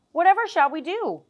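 desperate cry. Acted. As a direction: plead helplessly to the others. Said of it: "cried out in desperation".

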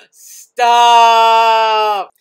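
long, loud stretched "stop"; drawn-out shout. Real, not acted. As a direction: holler it out long. Shocked at a meme.

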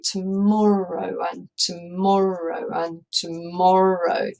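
Tomorrow and tomorrow and tomorrow, drawn out slowly in threes; slow dactylic. Shown as a demonstration. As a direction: let each tomorrow drag. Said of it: "it's in kind of threes".

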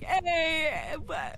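loud drawn-out "Hey" in imitation, dropping off; unintelligible French-Canadian grandpa. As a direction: imitate an old relative's loud, garbled French-Canadian call. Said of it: "He's just like".

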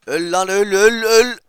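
loud, high-pitched shout at a foe; battle yell. Real, not acted. Gamer to an enemy he is finishing off, yelling. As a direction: yell it repeatedly in the heat of the fight.

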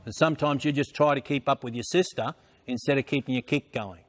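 usual speaking voice

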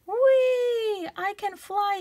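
high squealing whee of joy; squealing pig. Acted. Piglet squealing with joy on the trampoline. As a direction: squeal it out like a thrilled little pig. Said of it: "he squealed".

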